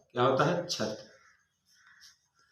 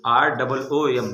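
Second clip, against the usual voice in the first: R double O M spelled slowly and a touch louder; slow spelling; 6 dB louder, slower than usual. Shown as a demonstration. Teacher spelling ROOM for the class, slow and firm. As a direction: spell out आर double O M deliberately. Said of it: "spelling the word room".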